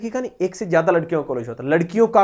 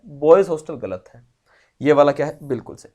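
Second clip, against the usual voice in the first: ordinary delivery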